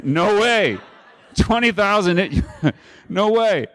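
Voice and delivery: high, amused disbelief; laughing mock refusal; incredulous no way. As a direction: scoff it off as the women would. Mock reaction of the ladies.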